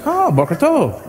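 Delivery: high, lilting mock delighted reply; imitated friendly recognition. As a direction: act out a pleasantly surprised local answering. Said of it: "They'd be like".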